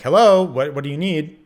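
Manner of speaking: loud, high call through the door. Retold or imitated. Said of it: "So I yell it again".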